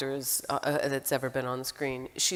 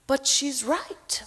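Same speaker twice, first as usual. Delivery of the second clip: slow, high, amused quote; drawn out with a laugh; quoting Meryl; 9 semitones higher, much slower than usual. Retold or imitated. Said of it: "and she'd say".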